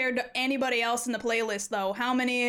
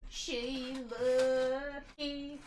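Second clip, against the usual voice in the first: put-on Southern accent; 5 dB quieter, much slower than usual. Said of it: "Southern accent, okay".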